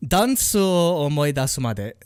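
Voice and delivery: "Danso o omoi dasu made" slowly, the Japanese way; Japanese pronunciation demo. Shown as a demonstration. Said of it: "you just pronounce it like o".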